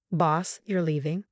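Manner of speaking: usual speaking voice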